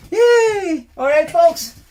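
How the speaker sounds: high, loud whoop of welcome; host cheering the folks in, loud and high; excited cheer. Acted. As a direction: throw a big happy cheer to the crowd.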